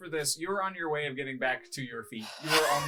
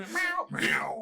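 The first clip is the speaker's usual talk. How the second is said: cat meow; 4 dB louder than usual. Pretend. Teasing the cat player.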